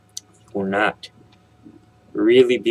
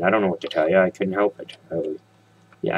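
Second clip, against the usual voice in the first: usual speaking voice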